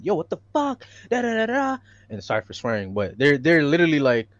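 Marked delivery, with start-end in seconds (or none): 0.0-1.8 imitating yelling bystanders. Retelling the video to friends, mock crowd yell. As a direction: toss off the crowd's yell, pitched up. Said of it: "hear those people saying".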